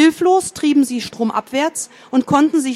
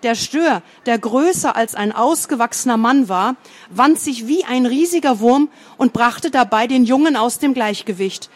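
ordinary delivery

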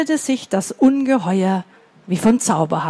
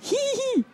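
high, soft tittering giggle; a tiny squeaky hee-hee; 12 semitones higher, 8 dB quieter than usual. Shown as a demonstration. Teacher modelling a giggle for the kids, high and soft. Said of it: "Ein bisschen lauter?".

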